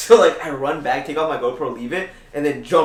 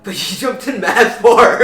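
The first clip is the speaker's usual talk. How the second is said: slow, through laughter, higher and louder; laughing; 6 semitones higher, 7 dB louder than usual. Real, not acted. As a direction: tease about how far he jumped. Laughing about friend jumping far.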